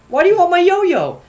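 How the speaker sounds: high, loud indignant protest. Retold or imitated. Boy to classmate wanting his yo-yo, indignant. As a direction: balk at the request, pitch shooting up.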